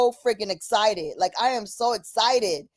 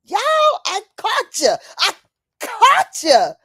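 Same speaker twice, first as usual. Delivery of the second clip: triumphant gloat; 10 semitones higher, 5 dB louder than usual. Real, not acted. Gloating at exposing demons.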